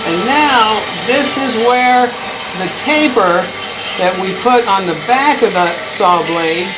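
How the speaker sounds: shouting over saw. Real, not acted. Speaking over running bandsaw.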